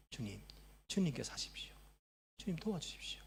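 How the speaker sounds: soft, hushed plea for help; quiet prayer. Acted. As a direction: entreat God under the breath. Models a prayer of dependence.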